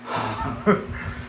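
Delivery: quiet breathy laugh; little laugh at himself; soft low chuckle. Real, not acted. Laughs after impression.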